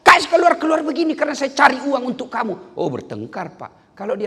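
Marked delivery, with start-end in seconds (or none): 0.0-2.7 higher, louder husband snapping back; hammed-up row between spouses; mock angry shout. Husband shouts back at nagging wife, hammed-up anger.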